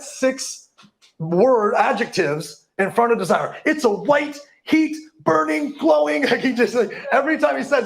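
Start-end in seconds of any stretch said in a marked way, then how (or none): 3.6-6.2 high, slow, hammed-up stress on each adjective; mock over-emphasis. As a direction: reach higher on every word, imitating an overblown author. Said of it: "really reaching for ways to emphasize".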